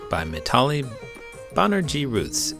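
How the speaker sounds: plain reading voice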